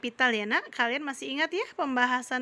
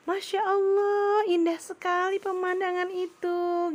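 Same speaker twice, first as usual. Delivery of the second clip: exclamation demo; 6 semitones higher than usual. Shown as a demonstration. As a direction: gush over the view to show a rising exclamation. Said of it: "itu meninggi nadanya kalau kata seru". (seruan kagum dengan nada tinggi dan pelan)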